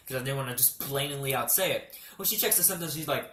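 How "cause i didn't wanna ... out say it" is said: normal talking voice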